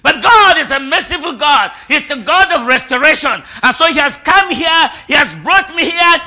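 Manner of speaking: shouted, high, fervent declarations; full-force preaching. Real, not acted. Preacher declaring restoration over the congregation, fervent shouting. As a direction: hammer out each declaration like a revival preacher.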